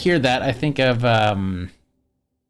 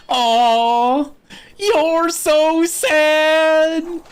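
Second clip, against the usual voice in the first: much higher, slow, sing-song put-on voice; Lester impression; 12 semitones higher, 4 dB louder, much slower than usual. Quoting Lester to viewers in a high, slow cartoon voice. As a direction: coo it like a pushy cartoon hillbilly. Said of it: "a big old hug from Lester".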